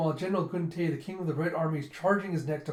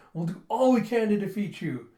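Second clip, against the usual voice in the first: high, loud put-on vow; fervent admirer; 5 semitones higher, 5 dB louder than usual. Commoners promising their lord a fight, fervent vow. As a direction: pledge it with overblown fervour.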